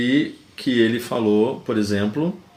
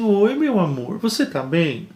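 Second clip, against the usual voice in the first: higher-pitched lovey-dovey reply; affectionate wife; 6 semitones higher, slower than usual. Acted. Wife answering her husband's pet names, acted affectionate. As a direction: play a wife cooing sweetly to her husband. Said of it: "um jeito mais carinhoso".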